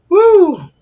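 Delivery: high loud woo; excited whoop. Real, not acted. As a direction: let out a happy whoop. Sudden cheer.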